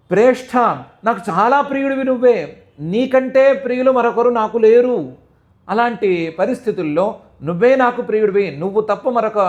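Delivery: high, loud pleading lament; crying out. Retold or imitated. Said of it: "అని అరిచింది".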